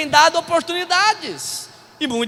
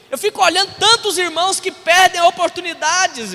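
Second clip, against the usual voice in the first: raised, higher preaching voice; 6 semitones higher, 5 dB louder than usual. Real, not acted. Pastor urging the church to seize its chances, preaching. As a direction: exhort the congregation with force.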